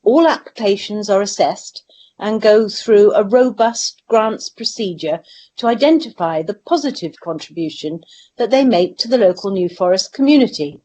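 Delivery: usual speaking voice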